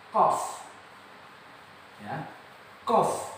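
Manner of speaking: short, clear qaf, closing on f; letter pronunciation demo. Shown as a demonstration. Teacher introducing the next letter, careful qaf. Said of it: "adalah huruf".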